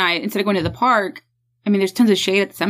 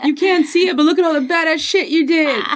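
announcer voice; 9 semitones higher, slower than usual. Pretend. Mock funeral highlight reel.

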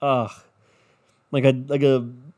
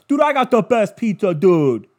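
higher, louder New York tough-guy brag; New York pizza guy; 5 semitones higher, 6 dB louder than usual. Retold or imitated. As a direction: brag about your pizza like a loud New Yorker. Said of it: "we're not New York".

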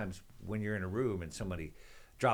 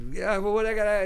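high, loud flustered scramble; sudden frantic panic, pitched up; panicked; 12 semitones higher, 10 dB louder than usual. Retold or imitated. Retelling a panicked comic at the bar.

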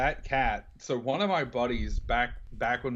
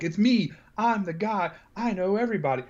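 raised, bragging tough-guy voice, higher; boastful Rocco; 7 semitones higher than usual. Retold or imitated. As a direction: brag that you know everyone.

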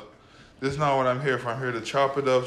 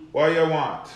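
normal talking voice

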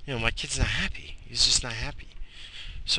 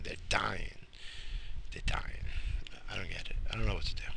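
whispered despair; 3 semitones lower, 10 dB quieter, faster than usual. Real, not acted. Despairing over dying plants.

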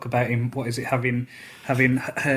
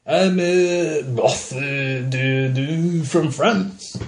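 slow, stumbling mock French; hammy French accent; much slower than usual. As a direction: overdo a fake French accent, groping for words. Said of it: "put on a hammy French accent".

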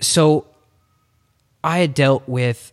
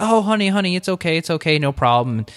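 high, gentle reassuring coo; calm a fussing toddler; soothing dad; 5 semitones higher than usual. Retold or imitated. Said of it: "And I was like".

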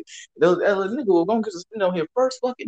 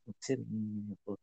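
very soft, low and quick; whispering; 8 semitones lower, 17 dB quieter, faster than usual. Retold or imitated. Asking his coworker on the quiet, whispering. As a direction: murmur it confidentially. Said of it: "we be whispering".

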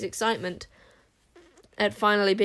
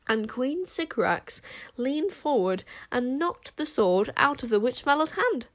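usual speaking voice

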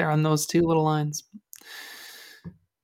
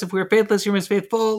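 brisk, even recitation of a verse; quick run-through; 2 semitones higher than usual. Shown as a demonstration. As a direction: rattle through it like a list. Rattles through the verse list.

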